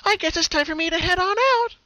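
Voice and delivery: high, cheerful pony voice; breezy farewell while fading; high amused cartoon pony; amused Pinkie. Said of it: "she laughed to herself".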